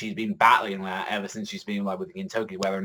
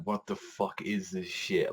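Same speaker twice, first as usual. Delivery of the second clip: hushed disbelief; 3 semitones lower, 7 dB quieter, slower than usual. Retold or imitated. Quoting Kyubey's reaction.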